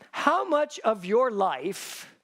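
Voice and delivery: slow: How much of your life do you live half-assed?; slow, weighty question. Real, not acted. Preacher asking the congregation a pointed question, slowly. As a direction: let each word land slowly. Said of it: "I have a question for you".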